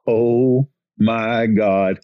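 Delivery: slow, drawn-out dismay; retold slow groan of dismay; imitated dread. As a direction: stretch it out like someone foreseeing disaster. Crew chief dreading the fallout, retold.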